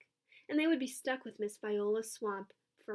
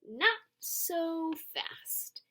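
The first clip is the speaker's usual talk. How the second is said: unpleasant hiss; 7 semitones higher, much slower than usual. A narrator's reading. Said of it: "hissed an unpleasant voice".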